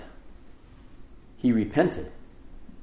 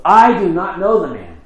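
louder, higher insistent denial; emphatic denial under oath; 6 semitones higher, 8 dB louder than usual. Acted. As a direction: swear it off, pushing them away. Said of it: "swearing an oath".

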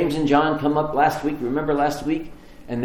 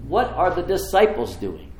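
usual speaking voice

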